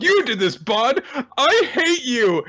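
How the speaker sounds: put-on high childish tantrum; hammed-up tearful fury, high-pitched; angry little girl. Child blaming the dog for the penguin, mock fury.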